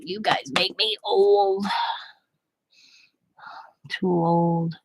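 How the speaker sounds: slow, breathy mock whisper. Kelly mourning his age, slow mock whisper. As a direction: whisper it like a worn-out old man. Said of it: "Kelly's like".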